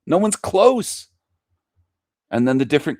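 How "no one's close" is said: mocking singsong. Shown as a demonstration. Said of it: "European by far".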